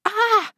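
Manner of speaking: sudden high startled cry; a gasped shriek of fright; startled scream. Acted. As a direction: yelp of fright when grabbed from behind. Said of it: "schrok ik ontzettend".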